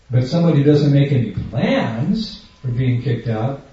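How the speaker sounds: ordinary delivery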